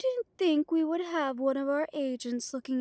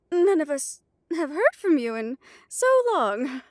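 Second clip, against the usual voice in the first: louder, higher, eager and flustered; eager greeting; 4 semitones higher, 7 dB louder than usual. Acted. Vampire leader welcoming her long-absent lord, eager and louder. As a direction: gush at the returning lord.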